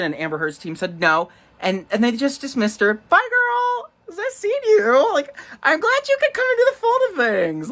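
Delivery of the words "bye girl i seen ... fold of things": camp sassy voice. Pretend. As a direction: gush like a campy queen. Camp voicing of court to Kate.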